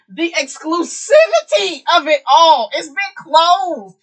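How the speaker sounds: high, loud, slow outcry; excited shriek. Real, not acted. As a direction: shriek it dramatically. Mock outrage at exclusivity.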